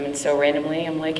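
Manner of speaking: ordinary talk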